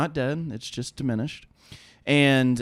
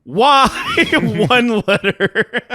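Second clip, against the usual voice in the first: high, loud "why" breaking into laughter; laughing bewildered whine; 8 semitones higher, 5 dB louder, much slower than usual. Retold or imitated. Storyteller mocking his reply to the stranger, high laughing whine. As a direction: laugh at your own useless reply.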